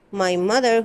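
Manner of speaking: plain reading voice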